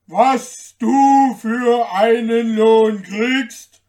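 very loud, slow giant voice. A narrator's reading. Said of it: "sagte der Riese".

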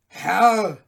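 loud call. Acted. Father calling to the merchant, loud. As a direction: call out to get his attention. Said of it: "rief Sabinens Vater".

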